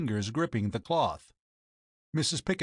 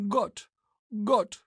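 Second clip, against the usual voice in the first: high, strangled cry of horror; choking cry; 8 semitones higher than usual. A narrator's reading. Captain sees the poisoned cat come out, choking high cry. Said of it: "A choking cry came from his lips".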